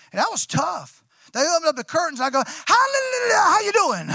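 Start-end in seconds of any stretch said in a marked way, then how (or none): 2.4-4.1 high, loud joyful shout; loud greeting. Retold or imitated. Pastor hails students as curtains open, shouting. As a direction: throw the greeting out to the whole hall.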